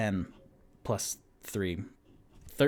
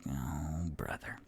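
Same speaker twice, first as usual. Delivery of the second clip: unvoiced, much softer sigh of annoyance; whispered aside; 6 semitones lower, 11 dB quieter than usual. Tipsy groaning to herself at the trouble, whispered. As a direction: breathe it under your breath. Tipsy mutters on seeing Thornin.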